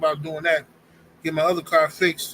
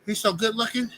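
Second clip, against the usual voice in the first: higher, breathless gushing praise; swooning admirer; 6 semitones higher than usual. Retold or imitated. Mocking a man gushing over a fighter, high swooning. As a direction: drool over him like a lovestruck fan. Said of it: "say that and say".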